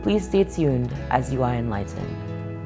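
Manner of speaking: ordinary talk, nothing marked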